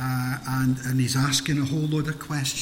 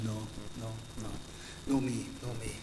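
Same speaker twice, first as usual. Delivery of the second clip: softer, cowering no; mock timid refusal; 5 semitones lower, 9 dB quieter, faster than usual. Frightened soldiers refusing to face the giant, mock timid. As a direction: shrink away from the fight.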